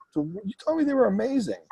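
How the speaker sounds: high-pitched mock disbelief; retold incredulous complaint. Client to agent after the buyers back out, mock incredulous. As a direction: question the agent's praise, pitch jumping up.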